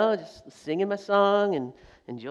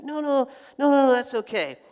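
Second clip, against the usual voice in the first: eager forgiving driver; 8 semitones higher, 4 dB louder than usual. Retold or imitated.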